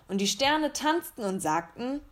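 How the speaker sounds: ordinary delivery, nothing marked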